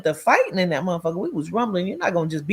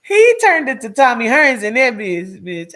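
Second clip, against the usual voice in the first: louder emphatic repeat; 6 semitones higher, 8 dB louder than usual. Real, not acted. Repeats laughing line louder.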